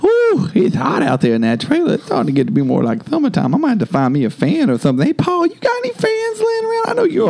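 high put-on hillbilly character voice; Richard Queso voice. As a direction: greet the fans as a hammy old character. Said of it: "I'm Richard Queso".

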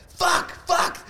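high-pitched cursing at a broken gadget; mock frantic shouting. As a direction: imitate a driver yelling in frustration. Acting out frantic driver cursing his car.

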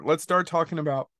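ordinary delivery

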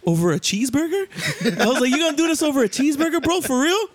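high, incredulous, half-laughing; amused mock incredulity, rising high; retold disbelief. Retold disbelief at gun over food.

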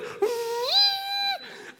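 long, high, loud horn hoot; imitated shofar blast. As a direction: sound a sustained ram's-horn call. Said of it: "[name]'s on the shofar".

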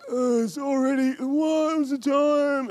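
put-on high, slow whiny kid; sleepy kid voice. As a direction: play a drowsy child asking the time. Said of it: "kids get up and they're like".

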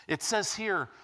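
ordinary talk, nothing marked

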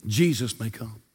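ordinary talk, nothing marked

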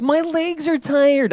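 high, drawn-out mock toddler complaint; three-year-old daughter. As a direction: imitate your toddler's tired whine. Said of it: "in her voice".

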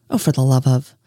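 low, fed-up groan of a line; exasperated. Acted. Detective fed up with Sherlock, exasperated mutter. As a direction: trail off in disgust.

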